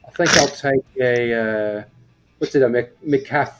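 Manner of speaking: musing to yourself in a hammed-up English accent. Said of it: "has an English accent".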